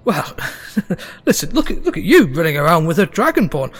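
loud, high, eager halfling hustler; excited, tumbling salesman patter; scheming halfling showman. Acted. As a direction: pitch a money-making show on the spot.

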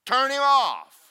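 imitating the wife cutting the mic; wife's orders. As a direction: bark it like a strict order to the sound man. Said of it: "she has strict orders".

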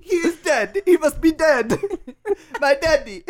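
high, mock-grieving pet voice; hammed-up high wail; Rolf. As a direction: mourn like a melodramatic puppy. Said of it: "the Rolf voice".

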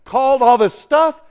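loud, high celebratory whoop, then high-pitched relief; jubilant onlookers. Acted. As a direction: whoop it up like a cheering crowd. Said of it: "They're going".

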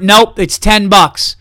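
staring them down, no budging; dead-eyed firm. Pretend. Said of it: "If they go".

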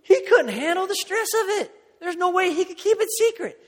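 loud, high-pitched amused ridicule; hammed-up high, hooting delivery; imitated laughing disbelief. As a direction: act out a woman cracking up at the idea. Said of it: "she just started laughing".

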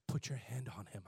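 low, soft calm instruction. Retold or imitated. Old man instructing young believer, calm and soft. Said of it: "He, calmly".

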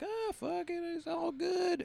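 high, airy don't-care voice; careless shrug. Retold or imitated. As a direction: brush it off like nothing matters. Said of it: "You're like".

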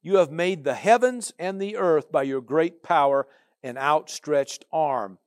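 loud, slow, preaching declaration; booming scripture read. Acted. Preacher booming Jeremiah's prayer to the Lord. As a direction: proclaim the prayer with full force. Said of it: "and he says this".

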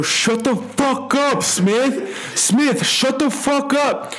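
high, slow, drawn-out mock yell; mad drunk yelling. Drunk friend yelling at Smith to hush, mad. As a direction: imitate an angry drunk hollering. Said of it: "he was all mad".

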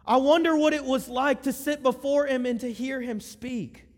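high, loud, passionate preaching; fervent youth pastor climax; preaching at full force. Acted. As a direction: build the fire and lift the room. Preaching climax, higher and louder.

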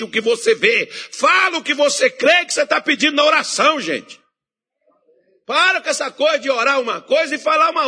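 loud, high fervent preaching; full-force preaching. Acted. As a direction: drive the congregation with conviction.

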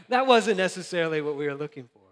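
real laugh running through the words. Real, not acted. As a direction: chuckle through the line at the joke on himself. Laughs at his own story.

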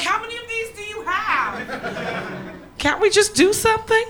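high-pitched, drawn-out exasperated plea. Acted. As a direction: whine it out in comic disbelief. Acted exasperated reply to stock phrases.

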